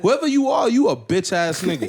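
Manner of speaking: loud insult starting high; mock outrage. Co-host addressing the kid who snitched, loud mock outrage.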